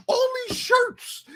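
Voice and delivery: high, slow mock voice of the supervisor; mimicking Kevin. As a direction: squeak it out as a fussy boss. Kevin voiced in retold story.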